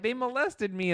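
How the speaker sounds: high whiny mock victim; mock whiny complaint. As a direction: whine it like a hammed-up victim.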